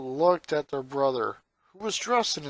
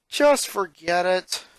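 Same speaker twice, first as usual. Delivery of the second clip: slow, louder giving up; defeated; 4 semitones higher, 6 dB louder, slower than usual. Acted. Lincoln gives up on his sister, defeated. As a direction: let it go with a sigh of defeat. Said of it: "in a defeated tone".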